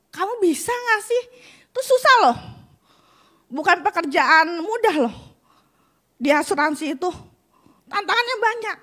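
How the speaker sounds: high doubtful relative, imitating their worry; doubting family. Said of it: "keluarga saya bilang".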